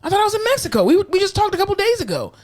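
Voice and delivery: high, bewildered mock patient; patient who thinks he is in Mexico, high and puzzled; confused patient. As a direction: play the lost patient, genuinely puzzled.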